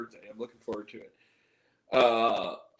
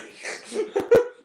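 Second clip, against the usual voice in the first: squealing high laugh at own joke; 7 dB louder than usual. Real, not acted. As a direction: break into a shrill giggle. Laughs after vine impression.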